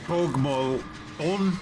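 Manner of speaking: "Pogmo on" said slowly and carefully, each syllable drawn out; Irish phrase demo. Shown as a demonstration. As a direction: slowly spell out "Pogmo on" like teaching a foreign phrase. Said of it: "You could say".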